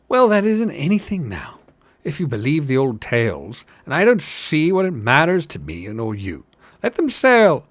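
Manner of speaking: high, loud scoffing tavern hobbit; dismissive hobbit. Acted. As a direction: shrug off the old tales with gusto.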